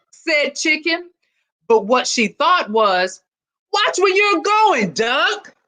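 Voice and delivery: put-on lively read-aloud for children; storybook reading. A narrator's reading. Principal reading a picture book to students, lively storytelling. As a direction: tell it like a picture book to a class.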